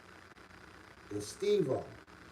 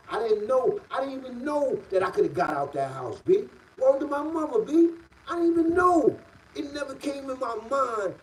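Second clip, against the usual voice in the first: incredulous outburst, pitched way up; worked-up disbelief; 10 semitones higher, 4 dB louder than usual. Real, not acted. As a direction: voice jumping much higher and louder in disbelief. Stunned disbelief at own naivety.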